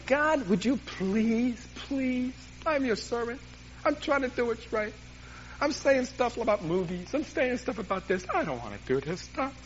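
high, drawn-out pleading whine to God; acted-out begging prayer. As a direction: plead softly and slowly, high in your voice. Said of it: "I was out there hooting and hollering".

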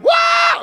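sudden high yelp of real pain. Real, not acted. Preacher yelps as his Achilles goes. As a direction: cry out as the tendon gives. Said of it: "That hurt".

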